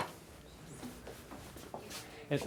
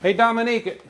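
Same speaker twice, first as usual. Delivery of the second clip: loud, high hallway call; 11 semitones higher, 7 dB louder than usual. Real, not acted. Host calling out to Dominique backstage, loud and high. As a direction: call her name down the hall.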